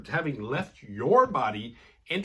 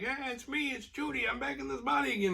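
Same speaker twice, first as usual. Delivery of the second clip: put-on old corpse lady voice; 11 semitones higher, 4 dB quieter than usual. Judy speaks from corpse body.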